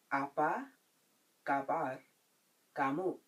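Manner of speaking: "Apa kabar kamu?" with long gaps between words. Shown as a demonstration. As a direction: give each word on its own for learners. Teacher breaks phrase up for learners.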